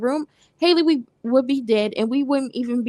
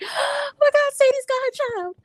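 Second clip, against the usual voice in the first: very high, squealing with joy, nearly crying; overjoyed squeal; 13 semitones higher than usual. Retold or imitated. Viewer reliving the reunion on screen, overjoyed squeal. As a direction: shriek it like your favorite ending finally came.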